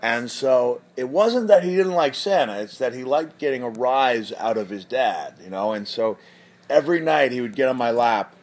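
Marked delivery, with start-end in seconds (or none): none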